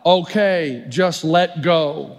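God answering, low and very slow; a lightly voiced booming God, slowed right down; slow deep God voice.

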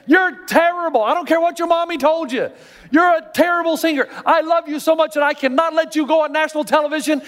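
high-pitched, exasperated plea; blunt friend. Acted. Honest friend to tone-deaf auditioner, exasperated and high-pitched. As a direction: plead with a friend, stretching the key verdict. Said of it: "where's the friend that will say".

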